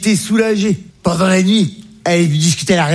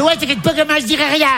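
much higher, laughing mother voice; mother's voice; 9 semitones higher than usual. Retold or imitated. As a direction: play the mum up high. Comedian voicing his mother.

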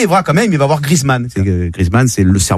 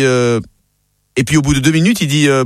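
ordinary talk, nothing marked

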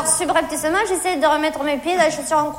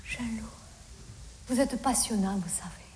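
very soft, lower-pitched flattery; admiring murmur; 7 semitones lower, 17 dB quieter than usual. Retold or imitated. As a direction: flatter him almost under your breath. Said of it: "Ah, j'ai fait". (flatterie admirative, tout bas)

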